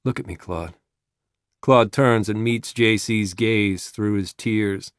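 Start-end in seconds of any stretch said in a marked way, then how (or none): 0.0-0.8 low, hushed coaxing; soft. A narrator's reading. Jesus to the sobbing gunman, soft and low. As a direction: lower and soften it to steady him. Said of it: "Jesus says softly".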